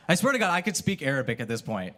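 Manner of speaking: normal talking voice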